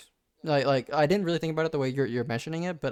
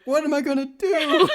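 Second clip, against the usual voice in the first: higher, laughing mock despair; overdone teen lament, half laughing; mock-anguished Chuck; 13 semitones higher, 6 dB louder than usual. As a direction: wail it like a melodramatic radio boy. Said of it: "a couple minutes later".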